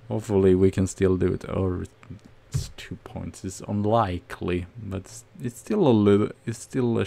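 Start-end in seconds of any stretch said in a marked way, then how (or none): none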